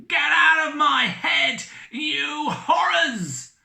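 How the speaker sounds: high, loud desperate shout; begging shout. Acted. Drunk captain begging the ghosts, loud high shout. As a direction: shout him out of your head. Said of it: "begged Captain Brazil".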